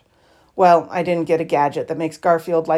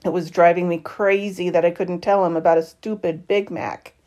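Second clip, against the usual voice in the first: ordinary delivery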